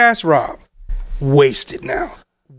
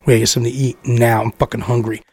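put-on much lower, quick drunk grumble; Rob; 10 semitones lower, faster than usual. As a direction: imitate a wasted, hungry friend, dropping low. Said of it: "Rob wasted".

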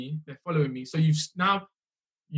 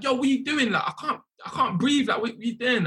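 imitating a panicked plea, higher and strained; retold panicked plea; 7 semitones higher than usual. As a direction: replay the panic of being choked, pitched up. Retold being held in headlock.

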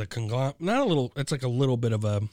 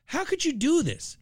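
high, anguished how could you; outraged plea; 12 semitones higher than usual. Real, not acted. Host scolding the brewery, high outrage. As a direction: demand it in disbelief.

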